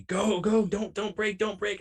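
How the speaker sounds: high loud yell at the car ahead. Retold or imitated. Yelling to the car in front, a high urgent shout. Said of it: "I'm, like, yelling to the car in front of me".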